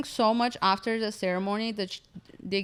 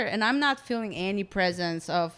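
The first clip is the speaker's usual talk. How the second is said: ordinary talk, nothing marked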